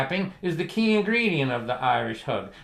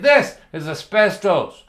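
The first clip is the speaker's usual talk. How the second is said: louder, higher, slowed-down declaration; emphatic announcement; 4 semitones higher, 6 dB louder, slower than usual. Retold or imitated. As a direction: point it out slowly and loudly. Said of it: "I said".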